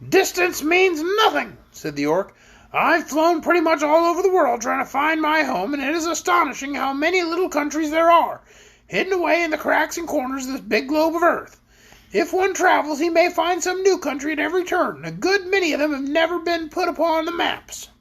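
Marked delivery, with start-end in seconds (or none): 0.1-1.6 high, drawn-out creature voice; Orc character voice. A narrator's reading. 2.7-17.9 high-pitched talking creature, held throughout; Orc character voice. A narrator's reading.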